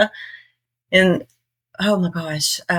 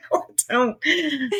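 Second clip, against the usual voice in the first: laughing denial, quieter; 10 semitones higher, slower than usual. Retold or imitated. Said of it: "they keep accusing me of having an accent".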